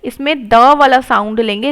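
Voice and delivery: ordinary talk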